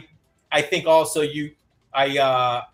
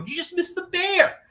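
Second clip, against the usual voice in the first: excited retelling. Retold or imitated. Parent telling daughter she missed the bear, excited. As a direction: squeal the news in re-enactment.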